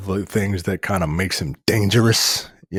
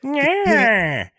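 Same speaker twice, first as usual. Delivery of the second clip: high, nasal Skeletor cartoon voice; squeaky villain voice; vintage cartoon voice.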